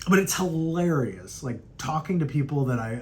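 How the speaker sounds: ordinary talk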